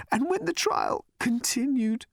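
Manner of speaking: high, tearful, whispered; weeping whisper. Acted. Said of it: "in a weeping whisper".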